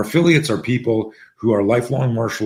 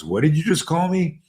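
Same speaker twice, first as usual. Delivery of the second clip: higher-pitched mock confrontation; retold bully's affronted question, voice jumping up; indignant challenge; 8 semitones higher than usual. Bully squaring up to Mitchell, indignant.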